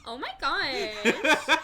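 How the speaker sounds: put-on slow drawn-out Valley Girl gasp; Valley Girl accent. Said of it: "Valley Girl accent".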